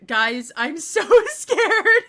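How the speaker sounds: slow, loud, nervous-laughing fear; scared laugh breaking into the words. Real, not acted. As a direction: draw it out, laughing with nerves.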